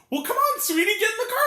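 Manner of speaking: high, loud mock coaxing; imitating coaxing woman. Critic mimics woman luring boy to car, high coaxing. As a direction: call it out high, as a put-on sweet lure. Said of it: "And she's like".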